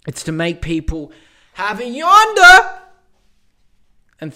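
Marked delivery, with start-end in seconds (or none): 1.5-2.8 very high, loud yonder; Bill Burr high inflection. Retold or imitated. Bill Burr impression, very high loud yonder. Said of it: "does a high inflection at the end".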